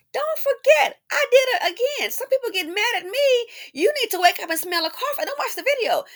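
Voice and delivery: very high, loud sing-out to the doubters; taunting. Real, not acted. Taunts viewers who get mad.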